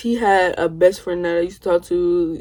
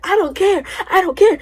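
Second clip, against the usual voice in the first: high mock yell, much higher; mock screaming back; 11 semitones higher than usual. Narrator screaming back at her cousin in the closet, high mock yell. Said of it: "And I was screaming back".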